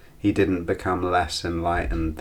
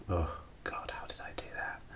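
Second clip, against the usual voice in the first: exasperated breathy whisper, much softer; 16 dB quieter, faster than usual. Real, not acted. Looking back on the long retreat in disbelief, soft whisper. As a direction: hush it like you can't believe you did it. Marvelling at past meditation hours.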